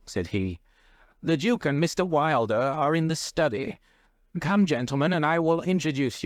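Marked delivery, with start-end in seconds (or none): 1.2-6.2 higher-pitched, mildly agitated headmaster. A narrator's reading. Headmaster ushers the gentlemen in to meet the Duke, mildly agitated. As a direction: speak up high with a touch of agitation. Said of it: "turned to us with agitation".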